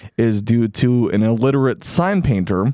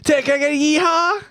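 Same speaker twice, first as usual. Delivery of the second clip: high, excited call-out; hype call; faster than usual. Acted. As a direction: pump up the crowd, pitched high. Hyping co-hosts.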